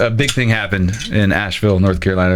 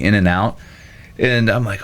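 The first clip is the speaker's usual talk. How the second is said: ordinary talk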